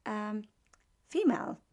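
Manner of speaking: ordinary talk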